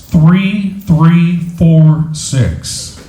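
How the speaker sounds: very slow, each digit separated; slow number call. Real, not acted. Raffle host reading out the winning number, slowly and deliberately. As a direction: announce each digit with long gaps.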